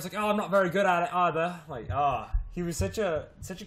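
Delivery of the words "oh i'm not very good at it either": quick mock British concierge; brisk, jokey British voice; British accent. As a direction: imitate him in a British accent. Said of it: "was totally British".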